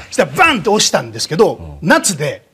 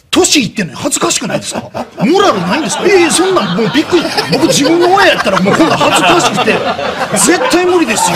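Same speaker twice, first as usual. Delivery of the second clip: mock berating; 8 semitones higher than usual. Berating the woman, retold.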